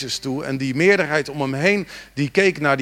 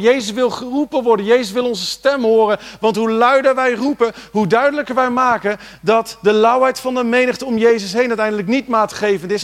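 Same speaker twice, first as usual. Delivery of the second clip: louder, higher, driving the point home; full-force preaching; 8 semitones higher, 6 dB louder than usual. Acted. Preacher urging the congregation to cry out, full force. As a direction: preach it out to the church.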